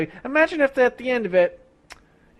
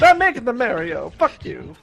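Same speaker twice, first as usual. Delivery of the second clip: put-on Mario accent; 3 semitones higher than usual. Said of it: "talking in a Mario accent".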